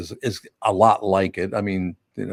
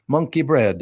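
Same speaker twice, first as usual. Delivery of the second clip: higher-pitched Italian toddler impression; Italian accent; 5 semitones higher than usual. Imitating friend's two-year-old asking for monkey bread, Italian accent. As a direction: do a little boy's Italian accent. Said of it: "has an Italian accent".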